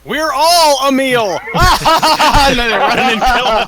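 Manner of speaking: high, loud laughing yell; laughing shout. Real, not acted. Joking, laughing loudly.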